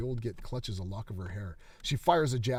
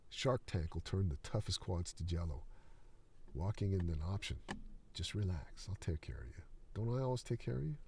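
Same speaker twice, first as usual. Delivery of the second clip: soft, low, falsely tender; creepy soothing murmur; 3 semitones lower, 6 dB quieter than usual. Acted. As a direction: lull her while closing in. Old coach grooming Ren softly.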